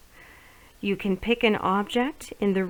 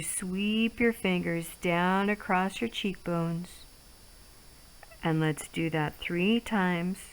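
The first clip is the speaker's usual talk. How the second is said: guided relaxation; 2 semitones lower, 4 dB quieter than usual. Acted. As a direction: ease them through each stroke softly. Leads guided face massage.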